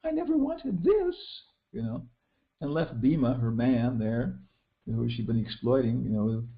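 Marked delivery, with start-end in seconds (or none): 0.0-1.2 high, slow, put-on woman's voice; mock Draupadi voice. Draupadi could have fled, imitated in high slow voice. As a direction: whine it as a faint-hearted lady. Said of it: "She could have said".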